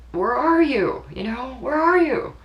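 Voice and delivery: high, pleading cry; calling out in desperation; desperate plea. Retold or imitated. Grieving mother begging her son, high desperate plea. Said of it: "And I said".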